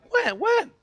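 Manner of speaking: sharp high "When?" rising in disbelief; baffled question. Retold or imitated. Righteous people baffled at Jesus' praise, asking in high disbelief. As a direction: ask it twice, the second shooting up high. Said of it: "they're like".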